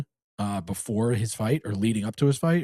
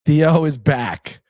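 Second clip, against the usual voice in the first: loud, drawn-out show announcement; announcer hype; 3 semitones higher, 8 dB louder, much slower than usual. Pretend. Host announcing co-host's return to the listeners, loud announcer hype. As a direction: proclaim it big to the audience.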